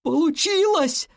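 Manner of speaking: high, drawn-out cry of triumph; joyful cry. A narrator's reading. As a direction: call out the success with delight. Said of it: "радостно воскликнул Сахли".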